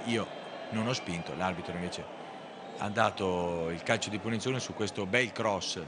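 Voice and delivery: normal talking voice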